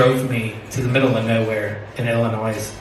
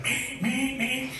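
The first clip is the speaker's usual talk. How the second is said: dirt bike noise; 12 semitones higher, 11 dB quieter, slower than usual. Retold or imitated. Grandkid ripping on dirt bike.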